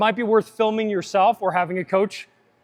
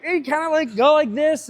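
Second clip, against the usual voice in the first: higher-pitched voice quoting yourself; retold hesitant demo; 6 semitones higher than usual. Showing his brother a failed move, awkward high-pitched retelling.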